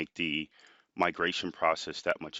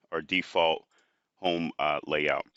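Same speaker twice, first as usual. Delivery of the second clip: ordinary talk, nothing marked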